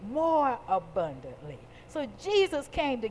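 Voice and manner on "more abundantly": low, weighty stress on "More"; emphasis on more. Shown as a demonstration. Preacher repeating the promise for emphasis, stressed "More". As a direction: lean on "More" without raising the volume. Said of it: "Put some emphasis on that".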